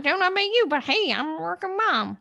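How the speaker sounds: high, loud, sassy put-on voice; sassy working mom. Riffing on the joke, a high sassy working mom. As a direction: toss it off with attitude.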